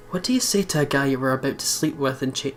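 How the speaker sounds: usual speaking voice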